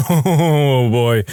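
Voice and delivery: real laugh running into the words. Real, not acted. Teller cracks up at own nun joke, laughing. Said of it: "is making me laugh more".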